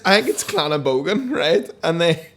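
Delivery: ordinary delivery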